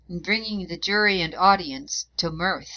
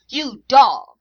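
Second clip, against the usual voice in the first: loud, higher, spat out in fury; furious shout; 5 semitones higher, 10 dB louder than usual. A narrator's reading. Mother shouting at the solicitor, furious insult. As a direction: hurl the insult at him. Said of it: "she cried".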